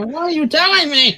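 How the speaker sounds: high, loud incredulous question; mock disbelief. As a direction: demand it in exaggerated shock. Joins in acting shocked at the cards.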